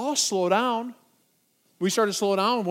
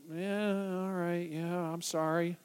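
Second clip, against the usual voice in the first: soft, apologetic mumble; sheepish; 2 semitones lower, 8 dB quieter, slower than usual. Retold or imitated. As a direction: give in meekly, much softer. Apologising for spoiling friends' outing.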